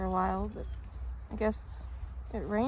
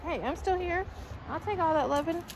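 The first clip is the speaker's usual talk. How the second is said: voicing the puppy; 6 semitones higher than usual. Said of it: "the puppy is like".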